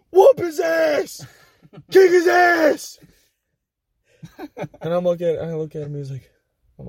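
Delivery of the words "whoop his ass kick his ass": high, slow shouted cheering; a friend egging on the fight with a drawn-out high yell; retold yell. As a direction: egg on the fight at full volume. Friend yelling encouragement.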